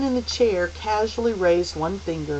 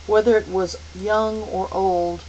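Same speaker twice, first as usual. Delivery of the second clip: usual speaking voice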